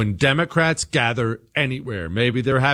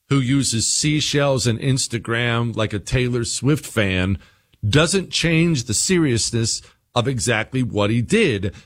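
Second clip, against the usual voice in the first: usual speaking voice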